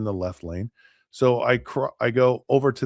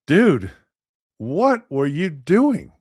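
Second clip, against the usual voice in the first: loud, high, drawn-out disbelief; incredulous scolding; 5 semitones higher, 5 dB louder, much slower than usual. Acted. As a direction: act the stunned cop confronting a driver. Officer confronts speeding driver.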